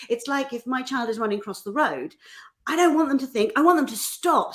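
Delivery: ordinary delivery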